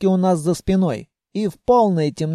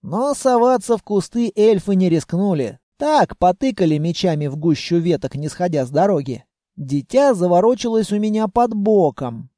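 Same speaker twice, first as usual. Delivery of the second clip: ordinary delivery, nothing marked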